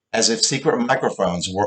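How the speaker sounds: usual speaking voice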